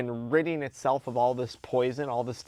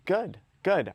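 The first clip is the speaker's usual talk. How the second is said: "Good, good" pushed higher, each word stressed; emphatic "good"; 6 semitones higher, 4 dB louder than usual. Acted. To himself waking up super sore, emphatic "good". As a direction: punch "good" twice, pitched up and falling. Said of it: "emphasis on the word good".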